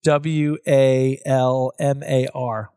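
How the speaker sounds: slow letter by letter spelling; spelled out slowly. Retold or imitated. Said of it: "spelled it out phonetically".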